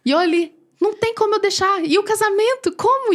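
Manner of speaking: retold panic rising toward the final como; rising distress. Debating God's command.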